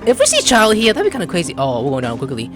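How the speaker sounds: ordinary talk, nothing marked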